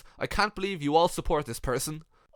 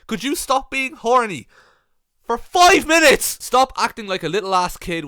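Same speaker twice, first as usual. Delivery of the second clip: mock outrage, louder and higher at the end; exasperated yell; 5 semitones higher, 7 dB louder than usual. Reading a comment to Scott, rising to an exasperated yell.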